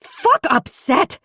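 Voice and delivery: very high, slow, drawn-out yell; raging outburst. A narrator's reading. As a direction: spit the words out in rage. Raging at the chief.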